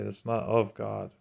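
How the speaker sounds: ordinary talk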